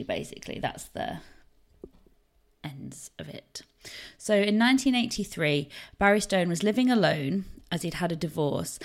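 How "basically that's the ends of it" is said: whisper. Real, not acted. Trails into whisper.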